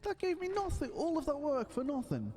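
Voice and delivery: squeaky disbelief at the empty reward; high whiny complaint. Real, not acted. Player finding nothing after mining, whines up high. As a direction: pitch it way up, whining about wasted work.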